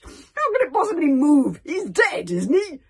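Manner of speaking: loud, high, indignant shout; irritable snap. A narrator's reading. Gobber scoffing that a corpse can't move, snapping. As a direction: bellow it as if it's obvious. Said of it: "snapped Gobber the Grouch".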